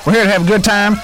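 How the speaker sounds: ordinary delivery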